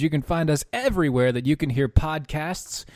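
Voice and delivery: upbeat show intro read; radio announcer. Acted. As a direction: announce the show back from break.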